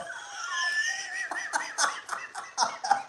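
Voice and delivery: a high, breathy burst of real laughter. Real, not acted. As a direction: laugh out hard at the chat comment. Reacting to chat joke.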